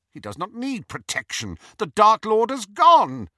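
rising to a loud bitter shout; bitter outburst. A narrator's reading. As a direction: snap it back defiantly. Snape's bitter defiance.

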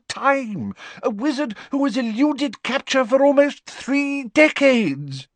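high, urgent minister pleading his case; anxious wizard official, pitched high; Fudge's voice. Acted. Fudge tells the Prime Minister they're at war, high and urgent.

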